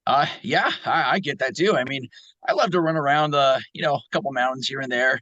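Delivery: put-on brash young hedgehog, full of himself; Sonic the Hedgehog. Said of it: "maybe you can do a Sonic".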